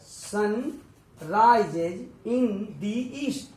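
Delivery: very slow, deliberate reading; slow demo. Shown as a demonstration. Teacher reads the example sentence very slowly. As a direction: draw out each word slowly. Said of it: "इसलिए".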